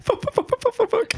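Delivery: squeaky high mock tire noise, way up in the voice; tire explosion imitation. Cohost mocking her blowout story with a very high tire squeal. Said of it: "une autre très bonne imitation d'un bruit de pneu qui explose".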